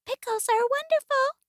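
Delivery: high, very soft mouse voice; tiny hushed squeak; whispering mouse. A narrator's reading. Mouse humours stern Bear, high soft whisper. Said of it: "whispered Mouse".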